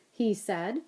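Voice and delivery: usual speaking voice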